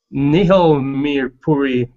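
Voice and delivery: normal talking voice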